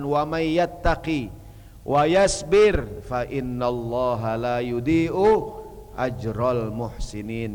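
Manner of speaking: drawn-out tajwid-style chant; Quranic recitation. Acted. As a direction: recite the verse slowly and devoutly.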